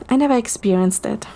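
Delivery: ordinary delivery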